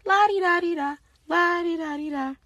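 high, sing-song brush-off; mock her boring answer in a lilting voice; airy mocking singsong; dismissive la-di-da.

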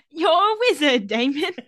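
loud, high mock British wizard-film voice; bad British accent. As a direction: ham up a terrible British accent. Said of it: "This accent is bad".

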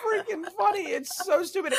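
high, laughing through the words. Real, not acted. Telling friends a silly movie scene, laughing high. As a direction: crack up while saying it.

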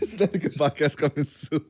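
cracking up mid-announcement; laughing. Shown as a demonstration. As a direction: fake promo read breaking into laughter. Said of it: "I couldn't even say with a straight face".

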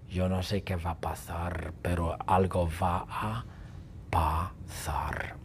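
whispered, low and ominous; hushed suspense. Acted. Narrator teasing what comes next, ominous whisper. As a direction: whisper it like a dark secret.

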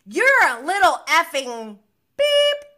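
high, loud imitated yelling; retold angry insult. Host as Katie going off at Raquel, angry insult. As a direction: hurl the insult, acting out the fight.

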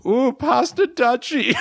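high, slow laughing German voice; hammed-up German accent with giggles. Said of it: "That's my German accent".

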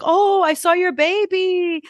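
high, slow, sweet imitation of a Portuguese accent. Housekeeper coos to the daughter in a high Portuguese accent. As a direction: imitate a Portuguese cleaner cooing in her accent. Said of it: "she's doing it with an accent".

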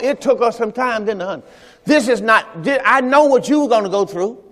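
ordinary delivery

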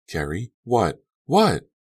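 frightened shriek. A narrator's reading. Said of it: "Mandy shrieked".

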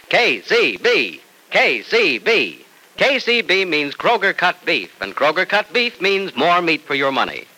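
upbeat vintage commercial pitch; radio ad read. Acted. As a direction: sell it like a radio sponsor. Sponsor ad read.

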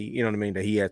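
ordinary talk, nothing marked

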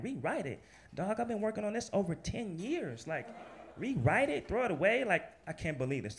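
high, indignant disbelief; stung and incredulous; high aggrieved disbelief; upset disbelief. Retold or imitated. Upset at rewrite request.

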